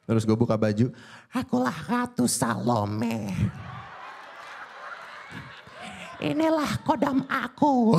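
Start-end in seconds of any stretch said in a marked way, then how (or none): 1.3-8.0 slow, high eerie spirit voice; possessed voice. Pretend. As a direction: drag it out like a spirit speaking through you. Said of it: "impersonate suara aneh-aneh". (suara kesurupan Ratu Salome yang lambat dan tinggi)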